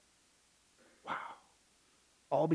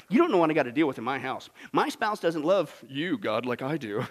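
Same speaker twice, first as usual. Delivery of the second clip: much softer and lower, fast then slowing; confiding mutter; 9 semitones lower, 5 dB quieter than usual. Acted. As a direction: sink into a self-pitying confession. Excuse-maker confides to God.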